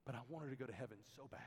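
put-on soft, quiet voice of a tearful ten-year-old; hushed and close to tears, lightly voiced. Daughter answering her dad in tears, soft and quiet. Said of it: "with tears in her eyes".

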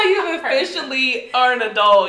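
normal talking voice

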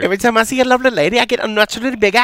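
high, loud, fast character voice; hammed-up high-pitched bit; put-on comic voice. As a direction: rattle it off in a high silly voice. Jokey line in put-on voice.

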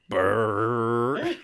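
lips buzzing like a car engine; a quick mock engine noise; engine brrr. Imitating car driving off.

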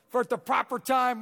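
the verse proclaimed high and loud; preaching the verse. Acted. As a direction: ring it out as the climax. Verse repeated at full force.

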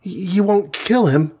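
high, halting plea; small high voice, tearfully pleading; pleading sob. A narrator's reading. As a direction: beg as if close to tears. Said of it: "begged the Wasp, with a sob in her voice".

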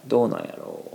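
Kansai-dialect phrasing of どうなんやろう. Shown as a demonstration. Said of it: "関西弁やったら".

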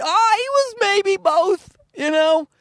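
very high, slow waffling; clueless audience member. Acted. Audience member asked about forgotten comic, clueless squeak. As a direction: squeak it out slowly, unsure.

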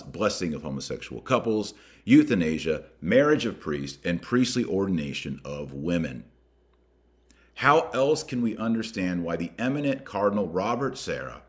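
ordinary delivery

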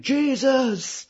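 high-pitched mock scream; Nick Cage. Nick Cage reacts to his pal being shot, mock scream. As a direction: imitate a film hero's scream, pitch way up. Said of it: "the way he screamed".

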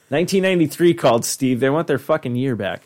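ordinary talk, nothing marked

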